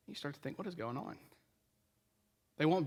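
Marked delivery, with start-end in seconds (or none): 0.1-1.2 low murmur, almost to yourself; a hushed what-is-going-on; hushed bewildered thought. Retold or imitated. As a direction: wonder it quietly under your breath. Listener's inner bewildered thought.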